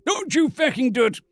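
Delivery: angry. Acted. Ronson repeats, louder.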